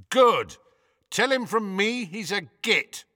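high, irritated call from far off; annoyed distant call. A narrator's reading. Ron yelling up from downstairs, annoyed distant voice. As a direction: call up crossly from floors below. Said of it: "Ron's annoyed voice echoed distantly".

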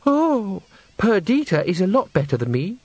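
high, airy little protest; breathless tinkle. Acted. Agnes demurs to the mirror voice in her friend's high tinkle. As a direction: start high on a little gasped oh. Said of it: "that breathless tinkle in the tone".

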